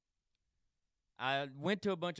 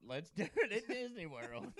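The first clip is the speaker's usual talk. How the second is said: laughing; 7 semitones higher than usual. Real, not acted. Laughing repeat of friend's idea.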